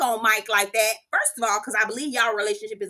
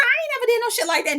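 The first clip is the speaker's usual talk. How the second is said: mock denial; 12 semitones higher, faster than usual. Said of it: "He told me".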